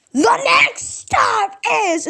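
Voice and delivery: high, drawn-out mock announcer voice; imitating the announcer. Vlogger mocking the subway announcer, high drawn-out imitation. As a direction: stretch it out like a harsh subway voice. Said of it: "is a little bit weird and harsh".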